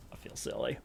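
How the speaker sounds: breathy whispered aside. Real, not acted. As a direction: whisper it to yourself. Embarrassed aside after retake.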